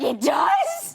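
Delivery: very high squeaked "It does?"; shocked. Retold or imitated. Mom retelling her alarm on learning about bears, high squeak. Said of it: "I was like".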